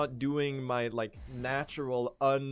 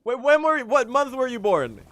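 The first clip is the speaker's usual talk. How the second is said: much higher and louder, urgent and excited; frantic demand; 11 semitones higher, 10 dB louder than usual. Real, not acted. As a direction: demand the answer right now. Urgently asks the birth month.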